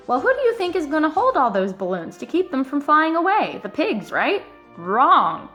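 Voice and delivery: usual speaking voice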